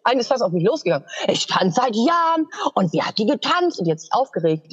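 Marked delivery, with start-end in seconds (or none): 1.3-3.8 high, drawn-out catty complaint; acted-out indignant whining, slowed and raised; bitchy imitation. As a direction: mimic an offended rival dancer. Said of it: "Manche wurden richtig zickig".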